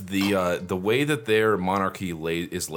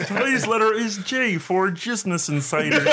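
high frog puppet voice, laughing; Kermit the Frog; 11 semitones higher than usual. Retold or imitated. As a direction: frog puppet host cracking up. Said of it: "Kermit the Frog here".

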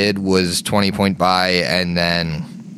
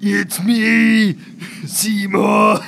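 high, stretched-out comic voice; put-on character voice; 12 semitones higher, much slower than usual. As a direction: announce yourself in a silly high voice. Puts on a character voice for fun.

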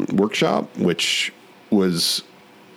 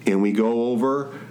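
upbeat podcast intro read; host voice, bright and lifted; announcer; 7 semitones higher than usual. Acted.

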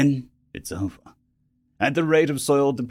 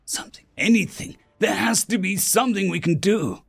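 higher-pitched desperate searching; grasping for any way out; desperate pleading; 7 semitones higher than usual. A narrator's reading. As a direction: think aloud in rising desperation. Narrator despairing, thinking desperately aloud.

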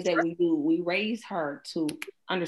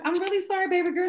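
higher, tender sorry to a little one; apologizing to her child; 13 semitones higher, 4 dB louder, faster than usual. Retold or imitated. Mother apologizing to her daughter, high and tender. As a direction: soften it like talking to your baby. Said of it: "And I'm like".

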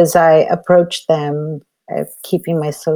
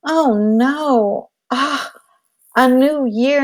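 higher, hammed-up child's dismay; dismayed little boy; 8 semitones higher, much slower than usual. Three-year-old boy groaning to his mom about New Year, higher mock dismay. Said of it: "And he says".

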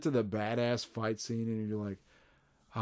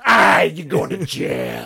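slow, loud, higher hammed-up shout; mock shout; 6 semitones higher, 13 dB louder, slower than usual. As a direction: bellow it like a cartoon villain. Parodying Jada's new attitude.

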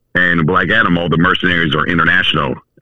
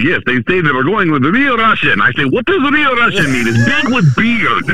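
higher-pitched, hammed-up Russian accent; 11 semitones higher than usual. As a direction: retell it in a big Russian accent. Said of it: "in my accent".

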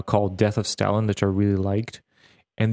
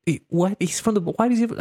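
incredulous outburst; 11 semitones higher than usual. Retold or imitated. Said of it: "and you're like".

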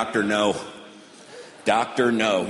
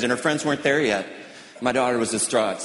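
normal talking voice

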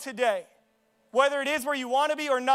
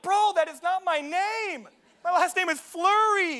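high, drawn-out complaint; exasperated protest; 6 semitones higher, slower than usual. Retold or imitated. Player correcting his coach on his name, exasperated. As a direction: protest slowly up high, put out.

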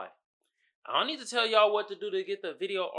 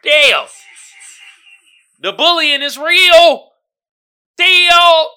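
loud slow exclamation; 9 semitones higher, 15 dB louder, much slower than usual. Real, not acted. Still laughing at meme.

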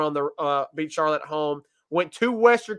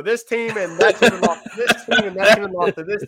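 rattling off a chain, half laughing; laughing through a list; 3 semitones higher, 6 dB louder than usual. Real, not acted. Speaker mocks the meme's chain of results, laughing. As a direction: spill the list out while laughing.

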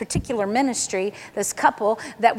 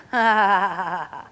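long, low run of chuckles; low laugh; 4 semitones lower than usual. Real, not acted. Preacher laughing mid-service before her story, low laugh. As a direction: let a loose laugh tumble downward.

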